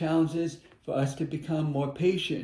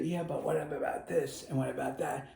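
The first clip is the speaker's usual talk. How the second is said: soft, nagging inner voice; a mind that whispers doubts; softer murmured what-ifs of the mind; 8 dB quieter than usual. Retold or imitated. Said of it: "I know the mind will say".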